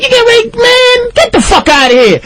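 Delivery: very high, incredulous mimicry; high-pitched mock dismissal; mock disbelief. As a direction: act out scoffing inmates, voice way up. Inmates dismissing him, acted out.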